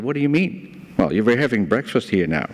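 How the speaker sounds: ordinary talk, nothing marked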